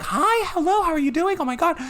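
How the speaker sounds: squealing high-pitched Madeline greeting; high mock greeting. Friend imitating Madeline's gushing hello. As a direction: gush hello in a high sweet voice.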